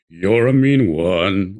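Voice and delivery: deep, slow, low-sung line; very low Grinch line. Shown as a demonstration. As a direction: drop as low as the Grinch song goes. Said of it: "I can actually sing it as low as the".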